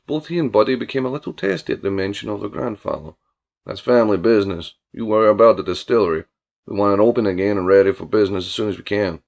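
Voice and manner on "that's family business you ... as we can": low-voiced country boss; brusque, disdainful Billy. Billy introducing Tulloch to his cousin, brusque low voice. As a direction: introduce him with open contempt. Billy's dialogue, story character.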